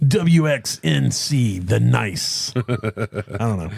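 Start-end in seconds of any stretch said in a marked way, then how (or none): 0.1-2.5 slow call letters, DJ style; radio DJ voice. Pretend. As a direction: announce it like a station ID. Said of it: "my F M radio voice".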